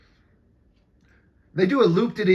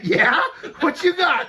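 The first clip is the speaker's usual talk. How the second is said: mock shouted challenge; 10 semitones higher, 5 dB louder than usual. Laughing shout quoting a cop.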